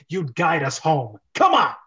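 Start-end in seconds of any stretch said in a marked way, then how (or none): 1.3-1.7 loud, high hurry-up shout; impatient hero yelling at Toad; urgent call. Acted. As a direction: drag him along with your voice.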